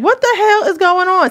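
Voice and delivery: high-pitched mock bewilderment; dumbfounded. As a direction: imitate someone stunned and clueless. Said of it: "stand there dumbfounded for a minute".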